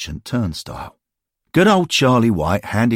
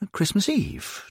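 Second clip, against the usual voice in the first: ordinary delivery, nothing marked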